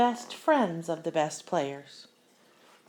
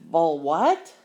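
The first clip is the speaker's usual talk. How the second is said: louder, confused "what?"; puzzled question; 7 dB louder than usual. Acted. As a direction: sound baffled by the word. Girl puzzled by strange food.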